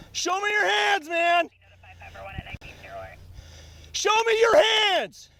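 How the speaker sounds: loud high barked commands; shouted surrender demands. Real, not acted. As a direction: yell the orders, slow and forceful. After shots, commanding the suspect.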